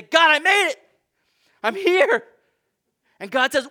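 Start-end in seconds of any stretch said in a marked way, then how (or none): 0.0-2.3 high, loud triumphant cry of arrival; a believer boasting he made it by himself; proud arrival. Acted. Believer to God, loud high boasting arrival.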